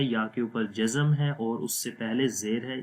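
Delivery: ordinary delivery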